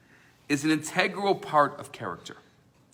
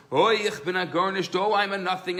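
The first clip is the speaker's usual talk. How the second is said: outdo the first one, louder; screaming louder; 3 semitones higher, 4 dB louder than usual. Retold or imitated. Second student in study hall crying louder he is nothing. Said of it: "the other one is screaming even louder".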